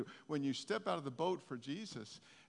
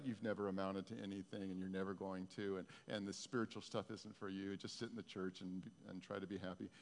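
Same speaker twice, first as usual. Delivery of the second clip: discouraging inner voices; 6 semitones lower, 8 dB quieter than usual. Acted. Preacher voicing doubts crowding in on a believer, quiet and low. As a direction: lightly voice the doubts in an undertone. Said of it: "the other voices crowd in".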